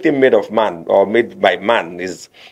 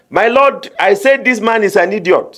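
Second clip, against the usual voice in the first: higher, eager plea to the judge; pitched-up mock lawyer; 9 semitones higher than usual. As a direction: play an excitable lawyer addressing the bench. Lawyer addresses judge in mock courtroom.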